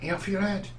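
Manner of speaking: put-on light Manchester accent on the quote. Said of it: "in a Manchester accent".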